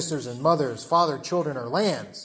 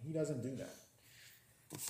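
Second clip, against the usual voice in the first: ordinary talk, nothing marked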